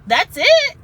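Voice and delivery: high, loud sudden relief on that's it; relieved surprise. Retold or imitated. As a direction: blurt it out like the pain just vanished. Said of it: "and you're like".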